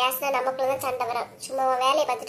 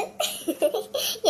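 breathy laugh; 6 semitones higher than usual. Acted. Gleeful gossip laugh.

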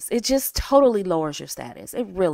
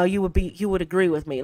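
normal talking voice

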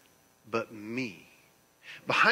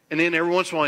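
loud preaching burst; 6 semitones higher, 9 dB louder, much faster than usual. Acted.

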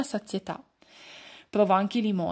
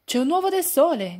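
loud, high excited cry; shouting; 7 semitones higher, 6 dB louder than usual. A narrator's reading. Pignetto shouting to the forest friends, loud and high. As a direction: call out the discovery to the whole wood. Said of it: "gridò Pignetto".

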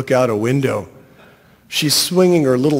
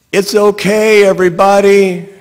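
high, loud call after a crowd; yelling; 7 semitones higher, 8 dB louder than usual. A narrator's reading. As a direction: yell it out to people walking away. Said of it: "I'm yelling after them".

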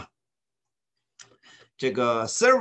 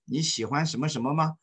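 ordinary talk, nothing marked

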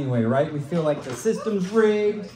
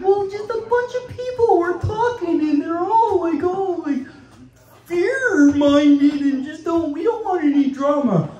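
mocking her voice; 11 semitones higher than usual. Comic retells being kicked out of the market, mocking. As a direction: imitate a fussy, drama-averse woman. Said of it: "She's like".

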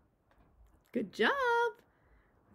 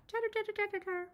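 higher and soft, quick monkey chatter; high-pitched monkey chatter; 11 semitones higher, 7 dB quieter, much faster than usual. Shown as a demonstration. Teacher showing preschoolers a monkey's chatter, high-pitched. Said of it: "Kind of more in a high-pitched".

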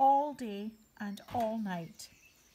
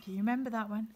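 usual speaking voice

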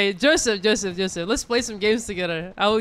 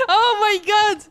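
very high, laughing cries of joy; ecstatic outburst; 10 semitones higher, slower than usual. Real, not acted. Gamer gets the rare skin, ecstatic high cries. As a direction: cry out in giddy triumph, high and laughing.

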